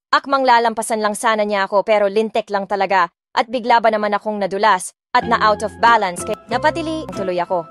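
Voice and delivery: plain reading voice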